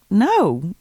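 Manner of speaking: mildly incredulous retort; a touch of disbelief, retelling it. Retold or imitated. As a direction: say it as if the question were absurd. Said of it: "you say that kind of incredulously".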